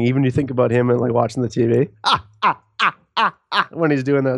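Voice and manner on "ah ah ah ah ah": high, halting "ah, ah" mock laugh; George McFly's weird laugh. Fan mimicking a silly movie character, weird laugh. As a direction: imitate a creepy laugh in separate bursts. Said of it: "That weird laugh".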